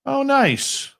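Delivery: sarcastic. Real, not acted. Sarcastic reaction to outage news.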